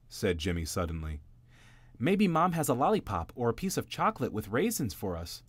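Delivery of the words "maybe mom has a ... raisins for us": bright eager little bunny, pitched up; a small child wishing for sweets; hopeful little bunny. Acted. Jimmy voiced in read story.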